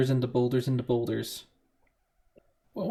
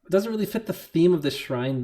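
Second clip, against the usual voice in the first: ordinary talk, nothing marked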